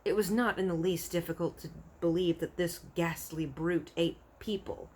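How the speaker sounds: ordinary delivery, nothing marked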